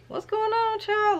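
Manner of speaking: high squeal of mock shock; shocked high what's going on. Reacting to a racy book scene, high shocked squeal. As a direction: shriek it in disbelief.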